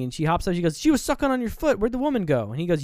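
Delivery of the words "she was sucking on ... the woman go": high-pitched mock indignation; bewildered protest. She hops up and accuses him, acted bewilderment. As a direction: blurt it out, baffled and accusing.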